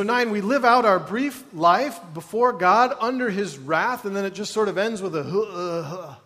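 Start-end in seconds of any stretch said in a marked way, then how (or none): 5.2-6.1 a weary, sinking groan of a sigh. Shown as a demonstration. Preacher demonstrating how a life ends, weary groan sigh. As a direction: let the breath sag out in a tired groan. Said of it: "I'm getting better at that, the groan sigh".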